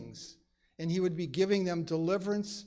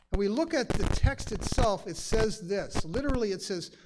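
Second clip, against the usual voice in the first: ordinary talk, nothing marked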